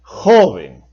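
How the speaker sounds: "joven" said JO-ven, first syllable strongest; "joven" stressed on its penultimate syllable. Shown as a demonstration. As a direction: put the weight on "jo" in "joven". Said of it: "debe pronunciarse joven".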